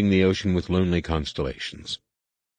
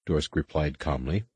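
ordinary delivery, nothing marked

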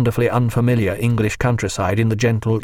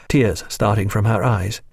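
usual speaking voice

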